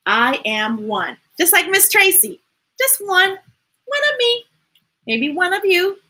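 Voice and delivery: a lightly sing-song storybook read; reading to children. Acted. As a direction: read gently to a room of kids. Reads picture book aloud.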